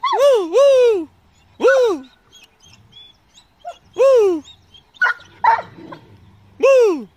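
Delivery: high loud wolf howl; mock howl. Real, not acted. Howling to dogs.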